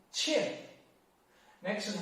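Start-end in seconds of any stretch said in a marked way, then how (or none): 0.1-0.5 "qian" falling fast from high; short sharp drop on "qian"; fourth tone. Shown as a demonstration. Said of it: "fourth tone".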